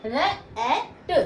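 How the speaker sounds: रैट stretched out slowly, vowel held like ऐ; teacher draws out रैट so kids hear the ऐ sound; slow demo word. Shown as a demonstration. Teacher to students, slow drawn-out demo of रैट. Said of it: "उसको कैसे बोलेंगे".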